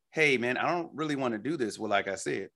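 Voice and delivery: ordinary delivery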